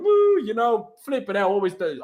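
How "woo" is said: mock excited whoop. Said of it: "I'm like".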